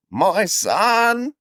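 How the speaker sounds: put-on high, slow shaky old man. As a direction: croak it like a frail blind elder. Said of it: "do an old man voice now".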